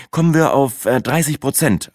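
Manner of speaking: proudly stated, after a small hesitation. A narrator's reading. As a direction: announce the figure with pride. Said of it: "antwortete ich stolz".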